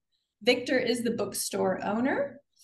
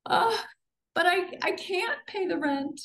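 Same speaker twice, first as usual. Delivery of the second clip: high, stammering distressed widow; helpless victim voice; 7 semitones higher than usual. Acted. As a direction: falter and plead, voice up high. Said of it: "Back to the fan and Savannah".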